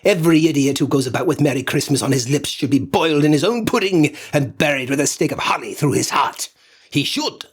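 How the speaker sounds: loud, fast indignant tirade; indignant outburst. A narrator's reading. Scrooge lashes out at his nephew, loud indignant tirade. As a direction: spit out the threat in outrage. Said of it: "said Scrooge indignantly".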